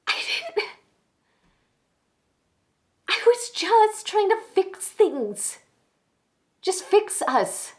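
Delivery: high, loud, frantic denial; distraught woman insisting on her innocence; panicked confessing woman. Acted. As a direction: protest desperately, pitch way up. Acted confession monologue.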